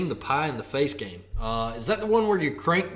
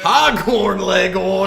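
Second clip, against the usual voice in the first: high, loud hammed-up cartoon rooster; 6 semitones higher, 9 dB louder, slower than usual. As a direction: crow it out like Foghorn Leghorn. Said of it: "everybody wants to see you do".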